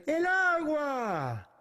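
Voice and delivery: high, drawn-out call to the singers. Shown as a demonstration. Teacher cues singers to jump into the verse, calling out. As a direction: call it out long, swooping down at the end. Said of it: "when I call out a verse".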